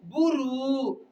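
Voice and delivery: ordinary delivery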